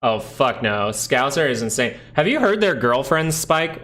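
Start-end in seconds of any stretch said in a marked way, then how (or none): none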